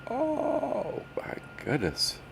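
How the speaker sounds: slow, high, breathy exasperation; drawn-out sigh. Real, not acted. Host groans as his browser keeps failing, exasperated. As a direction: stretch it out in weary despair. Sighing frustration at the computer.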